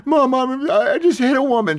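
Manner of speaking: high, loud, panicked calling for mom; a scared boy running back to mom; child crying out to his mother; frightened tearful cry. Retold or imitated. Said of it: "I'm in tears".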